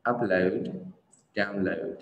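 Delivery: "upload", "download" with a gliding "lohd"; correct pronunciation. Shown as a demonstration. Teacher modelling the pair slowly, "lohd" endings. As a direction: stress UP and DOWN, round off "load". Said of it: "mình sẽ đọc là upload".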